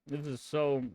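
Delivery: talking with mouth full; words through a mouthful of food. Real, not acted. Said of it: "I shouldn't talk in my mouth full".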